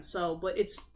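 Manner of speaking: ordinary talk, nothing marked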